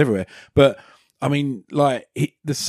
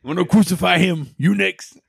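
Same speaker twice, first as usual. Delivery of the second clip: tough guy threat, higher; menacing threat; 5 semitones higher than usual. Retold or imitated. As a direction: growl a threat at a rival. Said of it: "I love that line".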